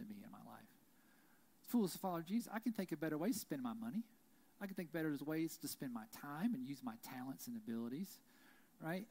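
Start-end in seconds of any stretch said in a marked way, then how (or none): none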